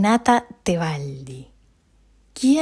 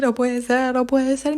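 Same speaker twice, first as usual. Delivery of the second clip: higher, mock-tearful disbelief; imitated crying; 5 semitones higher than usual. Owner retells crying to Ezequiel, mock tearful. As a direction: replay your weepy complaint. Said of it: "lloré, lloré, y dije".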